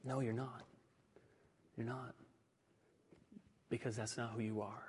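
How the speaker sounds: soft, steady, firm reply; gently but firmly hold the line; quiet firm reassurance. Retold or imitated. Pastor reassures a struggling man over the phone, softly. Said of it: "the pastor said to him".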